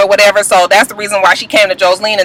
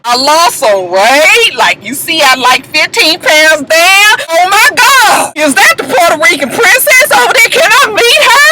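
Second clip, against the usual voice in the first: mock gushing; 6 semitones higher than usual. Imitated bartender asking to meet a star, mock gushing. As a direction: build to a high, over-excited squeal. Mimicking Shay gushing at Stevie.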